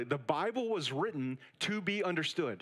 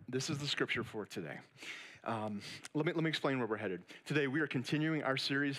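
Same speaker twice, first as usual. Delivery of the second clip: normal talking voice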